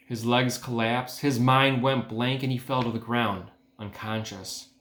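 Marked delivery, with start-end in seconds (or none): none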